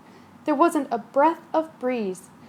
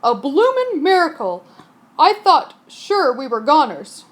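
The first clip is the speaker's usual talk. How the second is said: louder joyful cry; cried; 6 dB louder than usual. Acted. Captain rejoicing after the storm. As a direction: shout it with relief.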